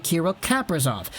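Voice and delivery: Kaprizov with the first syllable punched; front-stress the surname Kaprizov; stress on KAP. Shown as a demonstration. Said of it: "Emphasis on the K A P".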